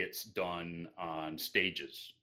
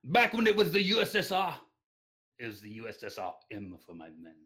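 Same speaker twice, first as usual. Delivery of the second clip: loud high hammed-up Russian accent; 9 semitones higher, 6 dB louder, faster than usual. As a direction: boast loudly in a thick Russian accent. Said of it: "in the most heavy Russian accent you can muster".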